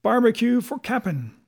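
high, loud rallying cheer; crew's cry. A narrator's reading. Mutineers want Barbecue back as captain, crying out. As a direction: chant support like an excited crew. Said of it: "they cried".

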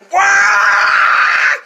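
long, high, very loud yell; imitated chainsaw roar. Storyteller as the chainsaw swinging at him, shouted roar. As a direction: blast out one sustained chainsaw noise.

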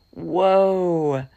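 usual speaking voice

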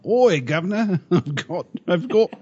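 mock Australian accent. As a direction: parody a cartoon Australian Wolverine. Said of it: "Hugh Jackman doesn't come on to Wolverine saying".

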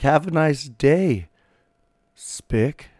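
slow, sugary then nasty; polite greeting turned insult; fake-friendly sneer. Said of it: "It's like".

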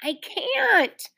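high, plaintive little wail; crying out. A narrator's reading. Ramona pleads with Mother at the door, crying out. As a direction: whine it helplessly. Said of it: "cried Ramona".

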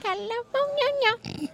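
high, very slow drawn-out squeal. Pretend. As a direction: stretch California out high and slow. Mocking pronunciation of California.

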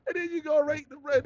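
normal talking voice

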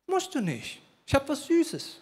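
put-on high, soft coaxing voice to a crying toddler; sweet neighbor. Neighbor coaxes the crying two-year-old, high sugary coo. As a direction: coo and tempt the little boy. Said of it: "sieht den weinenden Jungen und sagt".

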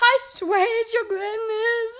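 high, slow, pleading squeal; frightened witch. A narrator's reading. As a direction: plead for your life in a high voice. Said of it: "began to shake with fear".

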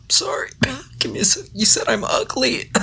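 soft, high, breathy whisper; frail whisper. Pretend. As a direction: whisper like a dying patient. Sick act whisper.

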